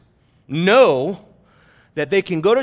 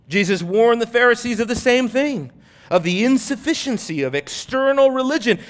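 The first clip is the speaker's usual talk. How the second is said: normal talking voice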